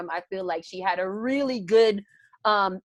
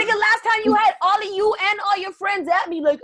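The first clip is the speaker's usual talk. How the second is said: heated; 11 semitones higher, 4 dB louder than usual. Real, not acted. Heated call-out at friend.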